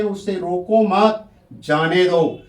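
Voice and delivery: रोको मत as one group, pause, then जाने दो; मत के बाद विराम. Shown as a demonstration. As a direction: break after मत, letting जाने दो fall. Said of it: "उसको रोकना ही नहीं है". (रोको मत कहकर ठहरें, फिर जाने दो)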